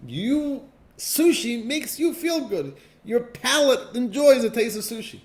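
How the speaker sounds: high, loud mocking tone. Real, not acted. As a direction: ham up the self-indulgence. Teacher mocks self-love.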